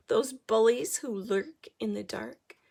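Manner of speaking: ordinary delivery, nothing marked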